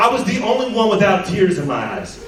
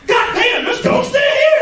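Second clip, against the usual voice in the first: much higher, shouted fake surprise; mock shock; 3 dB louder than usual. Guest in a haunted house, much higher shocked shout. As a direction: shriek it like a startled fool. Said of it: "walking into a haunted house going".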